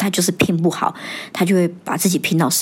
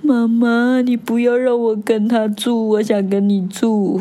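put-on slow, higher, whiny tearful little-boy plea; crying child; 4 semitones higher, slower than usual. Son crying that he wants to live with mom, imitated slow sob. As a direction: whimper it like a clingy crying boy. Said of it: "然后就哭了".

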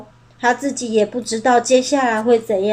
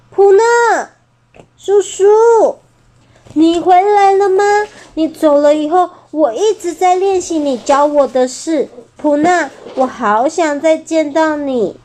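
big uncle overjoyed to see her again; joyful reunion; 5 semitones higher than usual. Acted. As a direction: cry out her name in relief. T-rex reunited with bird.

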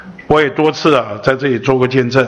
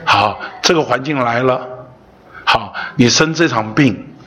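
normal talking voice

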